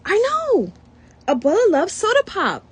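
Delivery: sudden high, loud eureka outburst; blurting. Acted. Beto blurting his idea to the family, high excited outburst. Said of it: "Then he blurts".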